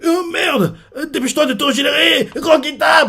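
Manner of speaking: voix très aiguë et paniquée; crie-le en suppliant, affolé. Retold or imitated. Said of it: "Il fait". (voix aiguë paniquée du roi Quinta)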